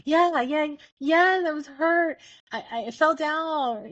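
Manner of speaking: put-on high, drawn-out child's whine; mock-pitiful kid voice, slow and high; hurt little child. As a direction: imitate a toddler whimpering about a fall. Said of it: "if they feel it in their bodies and go".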